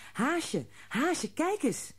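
high, slow excited calling. A narrator's reading. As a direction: call her over, thrilled. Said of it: "riep hij". (hoog en langzaam enthousiast roepen)

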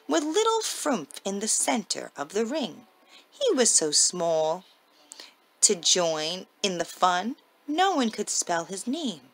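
usual speaking voice

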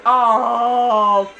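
loud drawn-out groan of frustration; frustrated yell. Real, not acted. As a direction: bellow a long exasperated oh. Something goes wrong in game.

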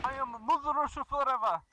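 hammed-up Russian accent, broken English; mock Russian accent, gamer joking; fake Russian accent. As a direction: play a comic Russian comrade in a Russian accent. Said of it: "doing this accent".

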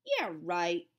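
low, loud, dismissive drawl. A narrator's reading. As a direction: drawl it in disbelief. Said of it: "drawled Lenny".

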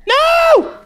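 high terrified scream. Real, not acted. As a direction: scream it in sheer fright. Monster coming at him.

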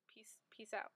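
soft whispered sign-off; breathy unvoiced goodbye; whispering. Real, not acted. Whispered goodbye ending video.

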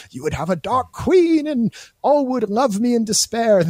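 high, grand queenly put-on voice; mock Galadriel. Host mimicking Galadriel's dark-queen line, high and grand. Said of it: "where she's like".

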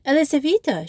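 sharp startled call; exclaiming. Acted. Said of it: "she exclaimed".

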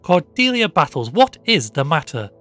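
high, loud demand; alarmed cry. A narrator's reading. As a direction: cry out sharply to the maid. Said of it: "cried Missus Townsend".

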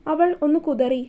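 plain reading voice

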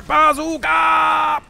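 high, slow, drawn-out call; yelling. Real, not acted. Player announcing the shot, yelling. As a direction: sing out the shout, stretched. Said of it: "the reason I'm yelling it".

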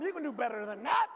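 loud line with a twang, rising at the end. Shown as a demonstration. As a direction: punch it out with a little twang. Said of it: "say it with a little bit of twang to it".

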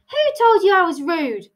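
high-pitched affronted demand; lightly indignant hare. Acted. Hare challenges Tilly, affronted.

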